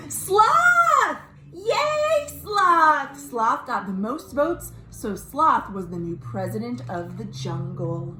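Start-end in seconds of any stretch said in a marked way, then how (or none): 0.1-3.2 high, loud cheering announcement; excited cheer. Real, not acted. Teacher announcing Sloth's win, excited cheer. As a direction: celebrate the winner with a big shout.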